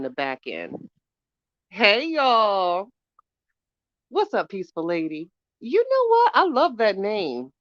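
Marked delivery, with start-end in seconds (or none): none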